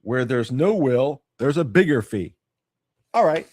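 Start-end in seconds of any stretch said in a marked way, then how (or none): none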